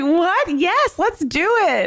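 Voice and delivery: high, eager mock enthusiasm; slow, high-voiced imitation of an eager follower; Stephanie impression. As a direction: pitch it way up, game for anything. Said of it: "She's just like".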